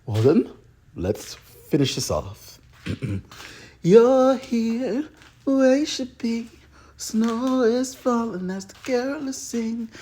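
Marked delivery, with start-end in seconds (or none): 3.8-9.9 story narration. Acted. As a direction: tell it like a holiday tale.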